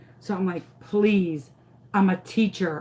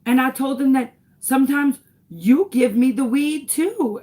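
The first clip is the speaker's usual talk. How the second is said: loud, high imitation of a kid; proud little boy, pitched up and louder; child's voice; 6 semitones higher, 7 dB louder than usual. Son telling mom what he told police, imitated child. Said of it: "He goes".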